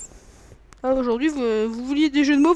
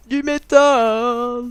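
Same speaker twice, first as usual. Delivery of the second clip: very slow, louder drawn-out metal growl; imitating King; 8 dB louder, much slower than usual. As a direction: stretch the word like a metal singer. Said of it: "comme dirait King".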